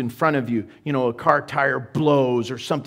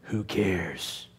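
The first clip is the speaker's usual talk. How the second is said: lazy shrug; 10 semitones lower, 9 dB quieter than usual. Pretend. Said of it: "I have to either just kind of".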